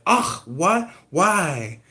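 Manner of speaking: dismayed outrage at the news; shocked Sarah. Retold or imitated.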